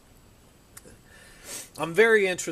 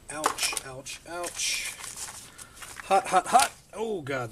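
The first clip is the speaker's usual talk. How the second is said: talking around a hot bite; gasping through food that is too hot; mouth full of hot food; slower than usual. Real, not acted. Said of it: "that was hot".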